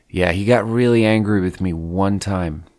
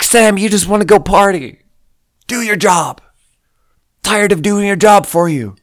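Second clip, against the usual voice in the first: higher, louder scolding of Sam; angry scolding; 9 semitones higher, 5 dB louder than usual. Retold or imitated. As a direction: snap at him to do his job. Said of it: "I get so angry".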